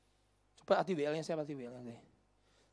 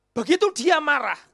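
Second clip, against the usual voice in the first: preaching shout; 10 semitones higher, 15 dB louder than usual. Acted. Preacher's angry peak.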